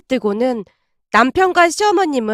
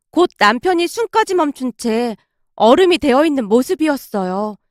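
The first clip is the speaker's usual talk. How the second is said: usual speaking voice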